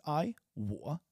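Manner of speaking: ordinary delivery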